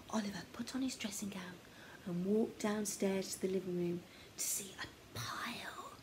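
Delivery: ordinary delivery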